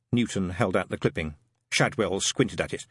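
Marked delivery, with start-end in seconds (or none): none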